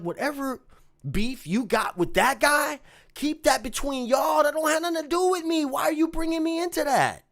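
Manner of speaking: high, loud, angry shout; confronting the manager, furious; heated confrontation. Retold or imitated. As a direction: shout him down to defend your name.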